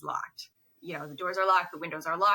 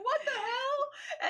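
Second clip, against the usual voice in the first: much higher, slow, laughing "What the hell?"; laughing mock outburst; much slower than usual. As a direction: squeal it while cracking up. [name] sees Vlad's cat face.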